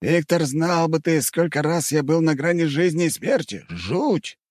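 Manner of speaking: narrator's light male character voice; Vadim voice. A narrator's reading. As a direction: voice him lightly, recounting the fight. Vadim's dialogue, male role. (вадим рассказывает о бою)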